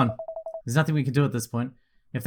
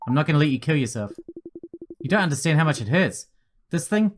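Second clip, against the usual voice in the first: usual speaking voice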